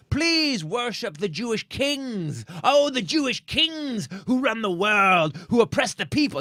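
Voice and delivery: high, drawn-out proclamation, acted out; imitated screaming rant. Traveler imitating a man ranting at the Southwest counter, screamed proclamation. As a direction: holler slowly like a public ranter. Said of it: "he's screaming in the airport".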